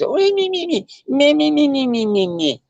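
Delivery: high-pitched nagging "me-me-me" gibberish; mock whining. Mocking whining over trouble at KFC.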